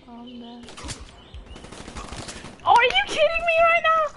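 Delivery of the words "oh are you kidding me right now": high, incredulous raging protest; high-pitched indignant yelling; outraged disbelief. Real, not acted. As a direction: protest wildly at an unfair kill. Outraged at being killed in game.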